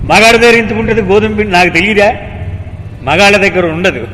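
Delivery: high, loud, fast retort; angry shouting. A narrator's reading. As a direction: shout back as an indignant sadhu. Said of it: "మళ్ళీ అరిచాడు వాడు".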